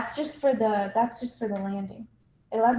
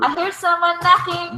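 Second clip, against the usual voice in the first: dramatic announcement; 8 semitones higher, 8 dB louder, slower than usual. Pretend.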